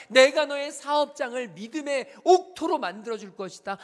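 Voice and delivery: building from firm to full shout; preaching at full force. Acted. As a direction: thunder God's promises over the congregation. Preacher voicing God's promises.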